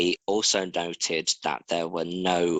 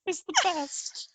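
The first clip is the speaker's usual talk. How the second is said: much higher, squeaky mock voice of an Aussie friend; playful put-on friend voice; 4 dB quieter than usual. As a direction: pitch it way up and gush like a cartoonish Australian. Voicing Hayley, laughing.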